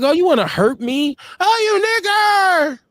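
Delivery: hammed-up high mock insult. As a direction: pitch it way up like a silly bigot.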